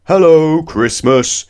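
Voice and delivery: loud high booming hello; booming giant. Acted. Giant wizard booms hello on the return visit. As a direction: boom it across the kitchen. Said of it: "boomed the giant voice".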